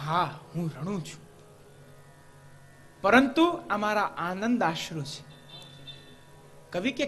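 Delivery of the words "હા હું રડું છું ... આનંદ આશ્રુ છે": hushed low pot voice, tears of joy; clay pot's soft reply. Acted. To the poet, a pot answers softly. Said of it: "સુંદર જવાબ આપ્યો".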